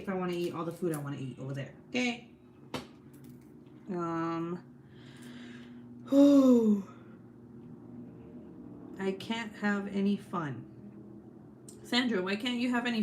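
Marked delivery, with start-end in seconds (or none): 6.1-6.8 loud whew. Real, not acted. Exhausted sigh about the diet.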